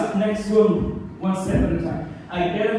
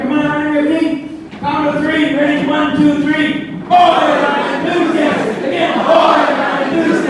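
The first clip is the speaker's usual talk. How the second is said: loud, high crowd-rousing chant leader; Carl Luther; 10 semitones higher, 6 dB louder than usual. Retold or imitated. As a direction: whip the whole room into an enthusiastic chant. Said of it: "And he had us all".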